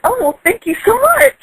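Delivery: high, gushing thanks; breathless high gushing thanks. Retold or imitated. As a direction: gush with flattered delight. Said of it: "completely gushing".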